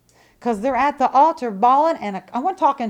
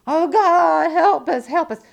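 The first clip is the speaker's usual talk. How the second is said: imitating a wailing plea to God, a bit higher and louder; mock begging prayer; 6 semitones higher, 6 dB louder, slower than usual. Preacher mimicking crying churchgoers at the altar, mock wailing plea. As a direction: ham up a desperate crying prayer. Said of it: "just crying and begging God".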